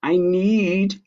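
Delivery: lightly voiced high little girl, insisting; a child who won't let the sheep stop; Ava insisting. Girl refusing to let tired sheep quit, high and insistent. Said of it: "said Ava".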